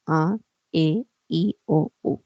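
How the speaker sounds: slow, careful Spanish vowel list; vowels said one by one. Shown as a demonstration. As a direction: spell out each vowel clearly. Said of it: "identificamos cinco vocales".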